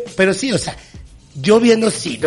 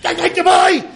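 mock yelling; 9 semitones higher, slower than usual. Cyclist yelling at pedestrians in the way, mock shouting. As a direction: yelp it out like an indignant cyclist.

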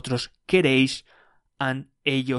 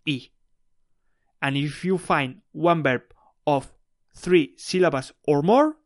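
ordinary talk

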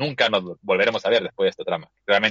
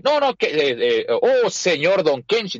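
put-on a touch of an American accent in Spanish; slow, mock American accent saying señor Don Kenshin; gringo accent; 5 semitones higher, slower than usual. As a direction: lightly imitate a gringo doctor speaking Spanish. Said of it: "con su acento claramente gringo".

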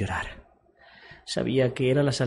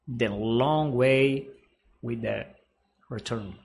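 slow dramatic English; much slower than usual. Shown as a demonstration. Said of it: "El largo camino de regreso".

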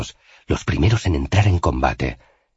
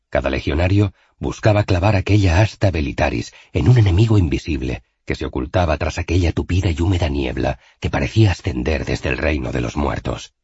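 plain reading voice

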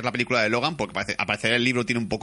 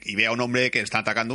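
ordinary talk

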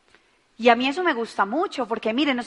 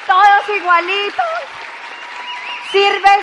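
high, louder rallying call; excited; 7 semitones higher, 6 dB louder, slower than usual. Real, not acted. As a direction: pump up the crowd. Rallying the audience.

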